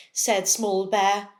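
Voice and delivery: usual speaking voice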